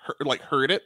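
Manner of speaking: ordinary talk, nothing marked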